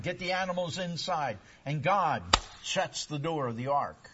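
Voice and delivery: preaching. Acted. Pastor telling the flood story to his church, rising. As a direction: build the scene of the flood.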